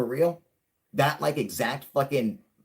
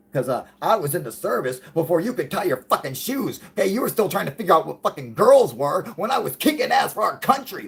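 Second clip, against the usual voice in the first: loud, higher-pitched mock tirade; acted indignant putdown; 6 semitones higher, 7 dB louder than usual. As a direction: put him in his place, raised voice. Acted indignant putdown in retold argument.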